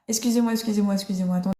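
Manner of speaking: ordinary delivery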